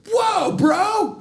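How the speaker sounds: high shout of alarm; shocked. Retold or imitated. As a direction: recoil in shock. Dad shocked by son's threat.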